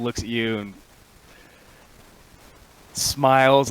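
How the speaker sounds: normal talking voice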